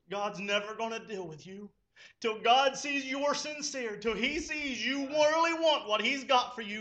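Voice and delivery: normal talking voice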